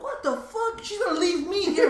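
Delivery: high-pitched, incredulous mock whine; retold indignant outrage. Drunk man learning his girlfriend left him, indignant. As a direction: act out being abandoned, voice way up high.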